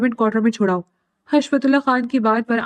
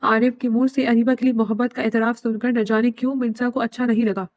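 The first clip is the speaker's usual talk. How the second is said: plain reading voice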